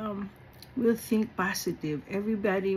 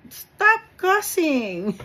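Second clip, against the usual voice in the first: higher, slow, every word hit; emphatic command; 7 semitones higher, 4 dB louder, much slower than usual. Real, not acted. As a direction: lay down the rule. Scolding the congregation.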